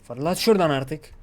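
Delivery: loud, exasperated command; frustrated shout. Real, not acted. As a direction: shout it as if out of patience. Frustrated gamer urges himself to launch. (bıkkınlıkla bağırarak)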